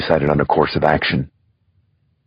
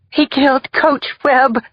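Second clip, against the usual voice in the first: hysterical sobbing; 3 dB louder than usual. Acted. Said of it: "she blurted between hysterical sobs".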